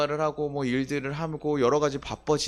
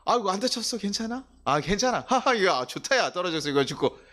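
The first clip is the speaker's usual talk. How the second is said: caring pastor; 7 semitones higher than usual. Pretend. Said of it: "이게 낫겠어요".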